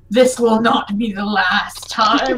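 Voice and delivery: slow villain's vow, low then rising; deep-voiced monster. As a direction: swear revenge as a little monster with a big voice. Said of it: "it has such a deep voice".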